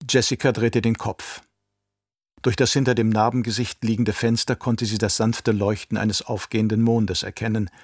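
ordinary delivery, nothing marked

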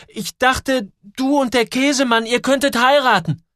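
high, urgent outburst; loud, high-pitched justification; shouted explanation. A narrator's reading. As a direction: cry out your excuse, pitched high. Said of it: "schreit Joel".

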